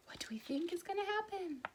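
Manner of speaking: quick, secret whisper. Real, not acted. Asking the children what happens next, in a whisper. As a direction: lean in and whisper the question.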